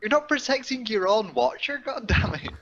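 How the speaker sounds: ordinary talk, nothing marked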